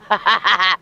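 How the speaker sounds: laughing through the words. Real, not acted. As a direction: laugh while you say it. Laughing at the park security.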